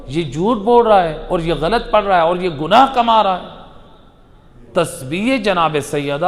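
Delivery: normal talking voice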